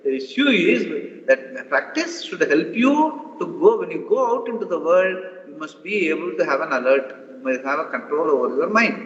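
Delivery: normal talking voice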